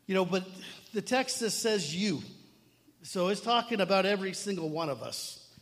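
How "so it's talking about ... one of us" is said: usual speaking voice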